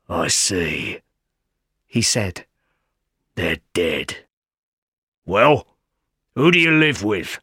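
put-on a rough stranger questioning the boy; menacing convict. A narrator's reading. Convict questioning Pip on the marsh, low menace.

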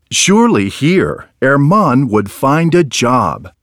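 ordinary delivery

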